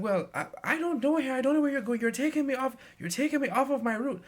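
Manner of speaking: put-on high, loud indignant old lady voice; complaining old lady. Bus passenger scolding the driver, high indignant old lady. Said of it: "It's like".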